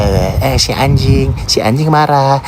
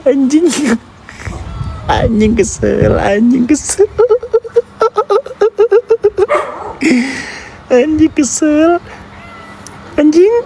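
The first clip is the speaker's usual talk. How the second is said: much higher, slow sing-song pet talk; 11 semitones higher than usual. Real, not acted. Teasing the angry neighbour dog, high baby talk.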